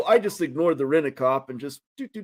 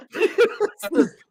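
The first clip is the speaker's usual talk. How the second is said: real laughter; 8 semitones higher than usual. Real, not acted. Laughing at own story.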